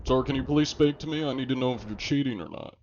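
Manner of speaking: prim official inspector voice; fussy bureaucrat keeping it courteous; polite inspector. Pretend. Inspector role talking to enemy pirates.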